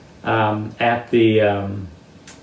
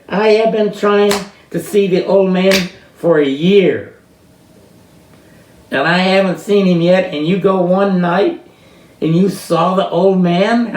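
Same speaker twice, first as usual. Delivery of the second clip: higher, louder amazed demand; incredulous woman; 8 semitones higher, 6 dB louder than usual. Retold or imitated. Woman marvels he saw the old man, louder and higher. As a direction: press him like a jealous seeker. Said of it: "this woman said".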